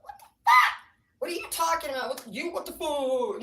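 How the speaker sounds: high screech, then loud protest; hammed-up outraged yelling; mock outraged mom. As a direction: shriek it, then ease into high indignation. Retold mother's outraged reaction.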